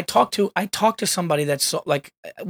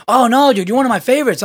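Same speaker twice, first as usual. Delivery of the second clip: high, eager backpedaling; 7 semitones higher, 5 dB louder, faster than usual. Retold or imitated. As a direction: gush to cover yourself. Fan backpedaling.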